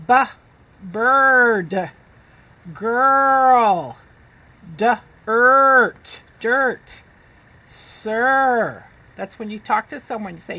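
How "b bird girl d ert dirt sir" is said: put-on "bird", "Girl", "dirt" stretched and loud; loud phonics drill. Shown as a demonstration. Teacher leading children in saying words aloud, loud. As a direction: sound out each word big for the class. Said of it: "Say it with me".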